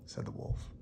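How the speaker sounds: plain reading voice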